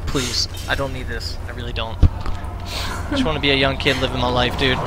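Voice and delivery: soft, low, beaten-down pleading; quiet weary plea. Real, not acted. Pleading softly with chat to drop the meme, low and defeated. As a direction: beg chat softly to stop.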